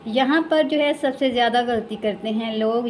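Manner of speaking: ordinary delivery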